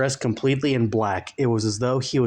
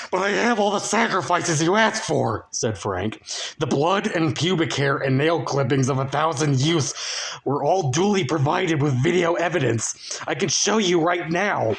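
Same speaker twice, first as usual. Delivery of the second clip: higher, anxious put-on voice; fearful plea; 7 semitones higher than usual. Frank anxiously pleading his sacrifices to the Dark Lord. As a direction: plead nervously for mercy.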